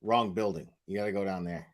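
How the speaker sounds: low, soft, deadpan redirect; office staffer. Acted. Office staff to woman at wrong building, low-key dismissal. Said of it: "them going".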